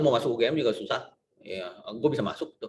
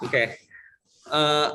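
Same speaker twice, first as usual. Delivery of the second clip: ordinary talk, nothing marked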